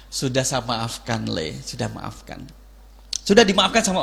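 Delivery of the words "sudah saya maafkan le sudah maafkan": soft, slow father forgiving his son; tender low forgiveness; gentle forgiveness. Retold or imitated. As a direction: quietly reassure the boy.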